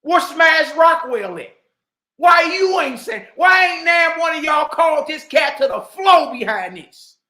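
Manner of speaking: angry shout. Real, not acted. Demanding why nobody challenged the scholar, shouting. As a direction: shout them down for staying silent. Calling out named people.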